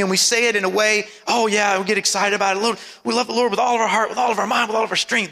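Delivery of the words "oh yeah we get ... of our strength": quick run-through with no weight on "all"; mimicking a fast, offhand recital; said in passing. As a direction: rattle it off without pausing. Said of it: "we say it in passing".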